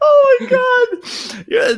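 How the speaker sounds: very high, drawn-out wail of laughter; laughing to the point of tears; helpless laughter. Real, not acted. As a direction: gasp it out through real laughter.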